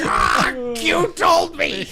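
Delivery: high, slow mock shout. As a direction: yell it out, hammed up. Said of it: "I'm just like".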